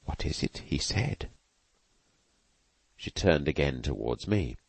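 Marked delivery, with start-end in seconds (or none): 0.0-1.3 breathy puzzled whisper; whispered to herself. A narrator's reading. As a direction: whisper the question to yourself. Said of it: "whispered to herself".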